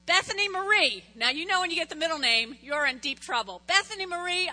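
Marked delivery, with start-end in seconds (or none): 0.1-1.0 put-on loud, high, warning call of a child's full name; stern full-name call. Mom summoning daughter to homework, loud and stern. 3.7-4.5 put-on high, loud repeat of the child's name; stern full-name call. Mom calling daughter again, loud and stern.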